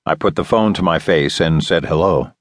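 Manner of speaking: usual speaking voice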